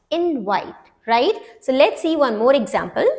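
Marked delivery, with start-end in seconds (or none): none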